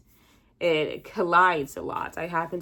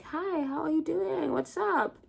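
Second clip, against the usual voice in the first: much higher, slow and chirpy; high cheerful imitation of a friend; mock friend greeting; 10 semitones higher, slower than usual. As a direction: imitate a bubbly friend saying hi. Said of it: "they're like".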